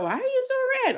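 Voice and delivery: high, lightly voiced curious asker. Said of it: "when people are like".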